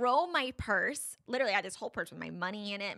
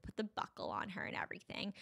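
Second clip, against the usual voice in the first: whisper; 9 dB quieter than usual. Real, not acted. Aside while telling story.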